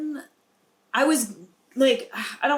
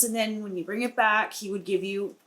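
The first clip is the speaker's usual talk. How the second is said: ordinary talk, nothing marked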